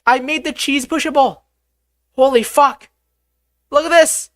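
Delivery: high-pitched, loud, excited exclaiming; thrilled discovery. Real, not acted. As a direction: blurt it out high and loud, amazed. Discovers the cheese can be pushed.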